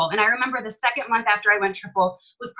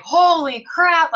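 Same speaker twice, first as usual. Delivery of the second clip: drawn-out, higher, louder holy crap; stunned exclamation; 7 semitones higher, 6 dB louder, much slower than usual. Retold or imitated. Retelling the moment she saw the check, stunned and drawn out. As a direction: let it out slowly in disbelief. Shocked at a big paycheck.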